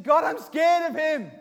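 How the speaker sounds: loud, high, drawn-out plea; impassioned, slow confession of fear; emotional, scared prayer. Acted. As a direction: cry out the fear to God. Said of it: "he puts some emotion in his prayer".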